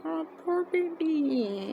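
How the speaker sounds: high, slow, drawn-out coo; long pitying coo, voice lifted high; cooing sympathy. Retold or imitated. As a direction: imitate someone consoling a poor little thing. Acting out his comforting a crying fan.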